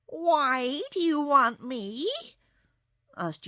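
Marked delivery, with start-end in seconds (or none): 0.1-2.1 high, loud, drawn-out cat voice; disturbed kitten. A narrator's reading. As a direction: ask slowly as an uneasy cat. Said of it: "disturbed by this threat".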